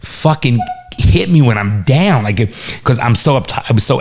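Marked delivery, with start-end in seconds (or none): 0.0-2.2 mock outrage. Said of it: "I'm like".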